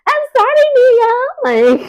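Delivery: high, slow, sugary mock apology; simpering apology. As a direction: mimic a meek people-pleaser. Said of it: "Jacqueline just be like".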